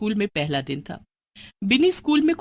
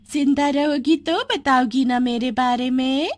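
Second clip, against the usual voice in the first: evil witch; 5 semitones higher than usual. Acted. Menacing witch.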